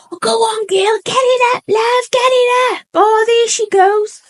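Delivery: high, loud jeering shout of onlookers; imitating a rowdy heckling crowd; mock cheering. Imitating onlookers cheering her vomiting.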